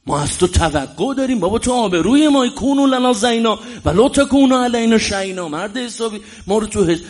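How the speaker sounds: preaching at full force. Acted. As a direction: plead with the faithful, rising.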